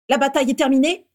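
loud, high, sharp question; furious. A narrator's reading. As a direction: demand an answer angrily. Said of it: "la voix furieuse de Bellatrix". (question furieuse)

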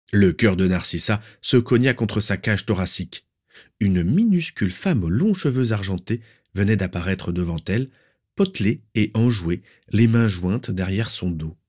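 plain reading voice

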